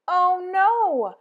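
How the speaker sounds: ordinary delivery, nothing marked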